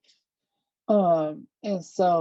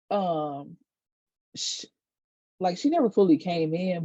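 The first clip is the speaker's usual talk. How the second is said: usual speaking voice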